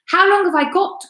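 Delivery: high, anxious plea; frightened cry. A narrator's reading. Wife begging husband how long she has. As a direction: cry it out, panicking. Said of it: "cried Missus Twit".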